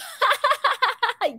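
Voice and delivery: a quick, high burst of laughter; amused high-pitched giggle. Real, not acted. Reacting to a comment.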